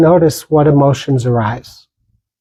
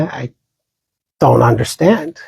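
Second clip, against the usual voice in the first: usual speaking voice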